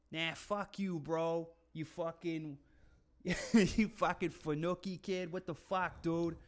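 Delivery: mock Boston tough-guy accent; put-on Boston bro accent. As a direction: imitate a swearing Boston dude, half laughing. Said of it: "it just sounds like".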